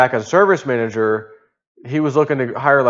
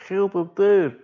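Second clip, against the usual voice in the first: mock plea; 6 semitones higher, slower than usual. Laughing imitation with boss.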